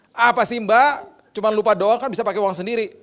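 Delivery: loud, high, irritated comeback; annoyed retort. Retold or imitated. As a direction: re-enact snapping back at the housekeeper. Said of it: "Kesel saya".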